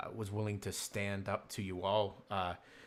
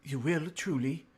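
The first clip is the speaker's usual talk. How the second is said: brightening hope; 5 semitones higher than usual. Acted. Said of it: "You see her brighten up".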